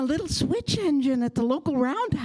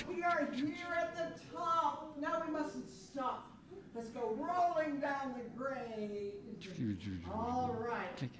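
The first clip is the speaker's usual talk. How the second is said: much softer, far-off voice; relieved engine; 14 dB quieter than usual. Acted. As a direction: ease off as it rolls down. Engine at top, rolling down.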